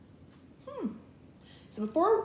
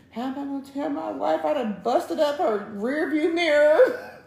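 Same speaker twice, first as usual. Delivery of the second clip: high, louder mock-panicked wail; 7 semitones higher, 4 dB louder than usual. Storyteller dreading telling the wife about the mirror, mock-panicked. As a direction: play up the dread of confessing.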